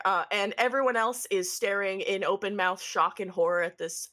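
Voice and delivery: ordinary delivery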